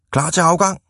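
high-pitched call for departure. A narrator's reading. Ivan at the wheel atop the hill, calling out. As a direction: call it out to the pushers. Ivan calls out before setting off.

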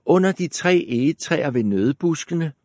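put-on light girlish voice. A narrator's reading. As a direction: innocent little girl answer. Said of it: "sagde Rødhætte".

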